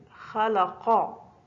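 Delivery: خلقا said very slowly, each sound clear; very slow classroom model of خلقا; slow example word. Shown as a demonstration. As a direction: draw out خلقا for the pupils. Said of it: "خا في أول الكلمة".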